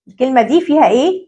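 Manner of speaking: ordinary talk, nothing marked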